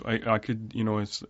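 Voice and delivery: ordinary talk, nothing marked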